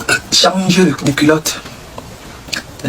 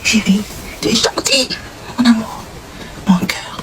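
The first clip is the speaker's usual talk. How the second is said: soft cooing endearments; 4 semitones higher, 5 dB quieter than usual. Pretend. Sweet-talking the man.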